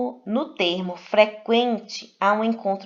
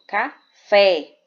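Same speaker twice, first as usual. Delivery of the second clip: café split as ca-FÉ, FÉ punched loud; stress on last syllable; 8 dB louder than usual. Shown as a demonstration. Teacher giving an oxytone example, café with loud final FÉ. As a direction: hit the FÉ of café hard after a short gap. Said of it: "a última sílaba é a sílaba mais forte".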